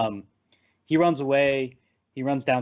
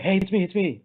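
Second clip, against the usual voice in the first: higher-pitched, hurried mock plea; urgent pleading; 7 semitones higher than usual. Transformed kid tells his friend it's him, urgently. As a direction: retell an urgent bid to be recognised. Hero urging Freddy to recognise him.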